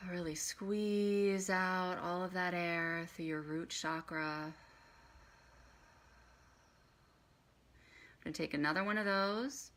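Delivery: soft, unhurried meditation guide; quiet voice with long pauses for breath; guided breathing. Acted. Leads breathing exercise for meditation.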